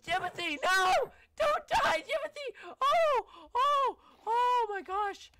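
frantic high panicked yelling; lifeguard shouting warnings. Pretend. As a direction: scream desperate warnings across the water. Frantic calls to horse in water.

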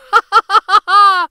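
high loud cackling laugh; gleeful cackle. A narrator's reading. Gingerbread boy laughing at chasers, high loud cackle. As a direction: cackle in triumph. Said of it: "he just laughed".

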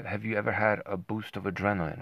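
ordinary delivery

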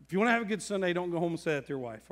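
ordinary talk, nothing marked

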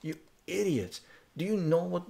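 heated retold outburst at pub strangers; retold rant. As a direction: tear into them again as you retell it. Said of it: "I fucking went off on them".

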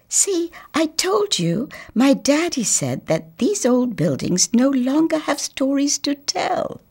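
put-on high, brisk little boy; a know-it-all little boy; boy's voice. Boy telling the girl, high and sure.

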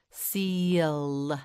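"Seal" slowly with long vowel and held L; stretched out. Shown as a demonstration. Teacher names the animal for learner, "Seal" stretched slowly. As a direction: draw out every sound in "Seal". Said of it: "Say seal".

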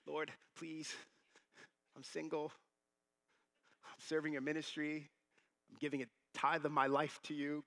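pleading prayer. Retold or imitated. Single minister begging God for a wife, pleading. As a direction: plead with long gaps, mock-desperate.

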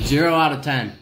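loud verdict. Real, not acted. Tasting terrible hot sauce.

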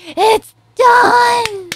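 loud victory shout; triumphant shout. Real, not acted. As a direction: celebrate his finish out loud. Character finishes the task.

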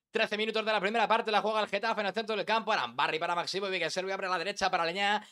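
live play-by-play. Shown as a demonstration. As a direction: rattle it off like a live goal call, climbing toward the shot. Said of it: "Imagina que vas narrando y hay gol".

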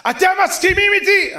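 high, loud shouted question; calling out. Retold or imitated. Appealing to the audience for agreement, loudly calling out. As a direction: call it out to a whole crowd.

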